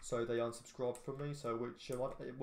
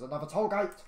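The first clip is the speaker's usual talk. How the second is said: sudden loud, higher shout; 7 semitones higher, 9 dB louder than usual. Real, not acted. Driver shouting on spotting another toll gate in game. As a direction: yell it out, caught off guard. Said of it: "sorry if I shouted".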